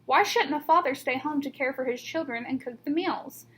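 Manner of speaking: ordinary delivery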